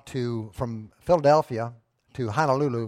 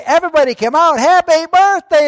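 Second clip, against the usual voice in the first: crowd shout; 14 dB louder than usual. Retold or imitated. Everybody jumping out to surprise Agnes, full loud shout. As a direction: yell it with the whole room.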